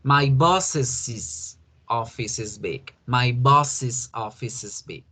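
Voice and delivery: boss's said as \"bosis\", slow and clear; slow model English. Shown as a demonstration. Teacher modeling another example for the class. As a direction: say \"boss's\" slowly, ending \"is\". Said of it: "El vestido de la princesa".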